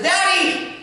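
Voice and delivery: put-on high, pleading child's voice on Daddy; mock kid asking dad for pizza; pleading little son. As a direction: beg like a small boy with puppy dog eyes. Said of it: "my son comes to me with those puppy dog eyes and says".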